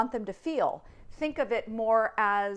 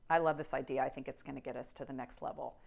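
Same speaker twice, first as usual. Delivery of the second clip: flat bored; 7 semitones lower, 8 dB quieter, faster than usual. Shown as a demonstration. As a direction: lower and softer, quick mumble. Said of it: "Here are some examples".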